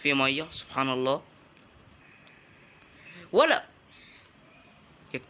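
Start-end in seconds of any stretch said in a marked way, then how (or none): 3.3-3.6 sharp call out. Real, not acted. Shouting at enemy.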